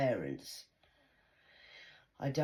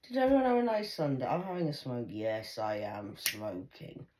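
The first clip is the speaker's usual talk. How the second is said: usual speaking voice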